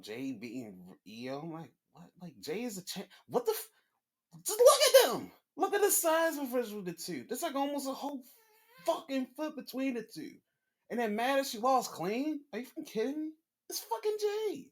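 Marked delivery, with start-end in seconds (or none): 3.3-14.7 high, loud outraged ranting; worked-up rant. Real, not acted. Ranting about Jade's size.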